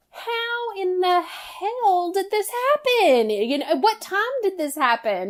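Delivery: high, slow disbelieving question; can't believe what she's seeing; incredulous. Retold or imitated. Mom seeing the photos, high and disbelieving.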